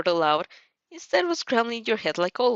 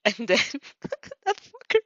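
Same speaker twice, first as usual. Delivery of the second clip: laughing; 12 semitones higher than usual. Real, not acted. Laughing reaction to the story.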